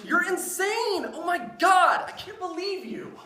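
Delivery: high, loud disbelief; exasperated outburst. Acted. Son reacting to cold beans, exasperated yelling. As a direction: yell your frustration at him.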